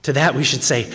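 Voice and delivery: ordinary talk, nothing marked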